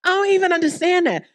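can't believe it, exclaim it; incredulous. Real, not acted. Preacher marvelling at parents mad at their kids.

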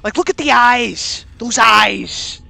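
loud, rising shout of fright; frightened outburst at the screen; horrified shout. Real, not acted. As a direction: yell at the creepy eyes in real fear. Horrified at the chicken's eyes.

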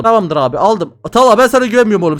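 ordinary talk, nothing marked

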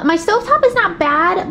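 ordinary delivery

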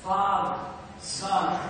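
normal talking voice

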